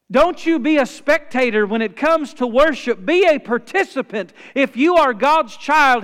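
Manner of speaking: loud, high-pitched pulpit proclamation; forceful preaching. Acted.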